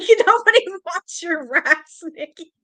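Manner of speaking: high, loud, slow mocking quote; mimicking a friend's jab. Streamer imitating Teresa teasing about rats, amused mockery. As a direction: stretch it out high, amused by her bluntness. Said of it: "'cause she said".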